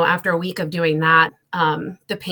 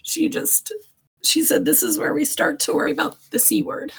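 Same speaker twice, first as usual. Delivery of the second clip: higher, tear-choked voice breaking through the memory; real crying; 10 semitones higher than usual. Real, not acted. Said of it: "I'm gonna get emotional".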